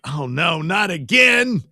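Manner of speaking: mock wail that rises to a shout on again; hammed-up dismay. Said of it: "he could go like".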